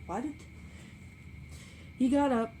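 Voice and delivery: ordinary delivery, nothing marked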